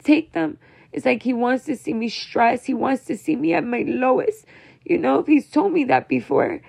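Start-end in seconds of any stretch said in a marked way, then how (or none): 0.0-0.6 exasperated outburst. Retold or imitated. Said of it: "Like, I'm like".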